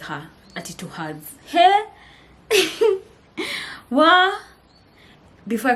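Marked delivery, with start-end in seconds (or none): 1.5-4.3 high, loud bright greeting; a sudden cheerful hey; excited hey. Retold or imitated. Retelling how she greeted her mom, loud excited hey.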